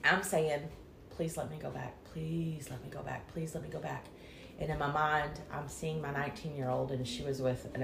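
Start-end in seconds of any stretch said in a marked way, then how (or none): 1.1-4.0 soft, hushed, begging repeated; quiet pleading. Retold or imitated. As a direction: plead quietly, almost under breath. Pleading to God near death.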